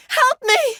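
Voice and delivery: very high, loud scream for help; cry for help. Acted. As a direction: shout desperately for rescue. Crying for help.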